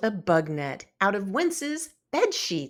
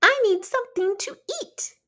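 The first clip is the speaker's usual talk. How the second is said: high, fast little bug voice; very high, fast tiny bug demanding food; buzzing bug voice; 12 semitones higher, 3 dB louder, faster than usual. A narrator's reading. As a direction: squeak it out like a hungry insect. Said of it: "the worry bug buzzed".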